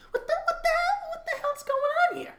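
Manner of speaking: high, laughing hammed-up what-the; mock disbelief. Host reacting to measles numbers for the audience, in a high, laughing mock-shocked voice. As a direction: squeal it in fake shock.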